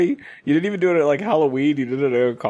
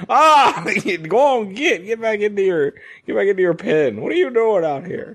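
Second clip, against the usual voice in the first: mock shepherd; 7 semitones higher than usual. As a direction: shoo the guests back into their pen.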